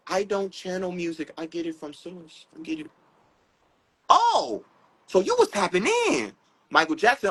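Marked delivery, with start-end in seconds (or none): 0.1-2.9 soft, hushed quoted voice. Retold or imitated. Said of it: "Because [name] said". 4.1-6.3 high, exclaimed "Oh" of discovery; dawning realization. Acted. Acting out his sudden realization.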